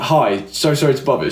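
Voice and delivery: normal talking voice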